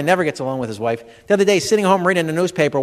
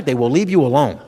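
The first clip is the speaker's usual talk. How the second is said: ordinary talk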